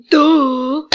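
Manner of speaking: shouted sarcastic duh; mock-obvious yell; loud duh. Real, not acted. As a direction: blurt out duh like it's obvious.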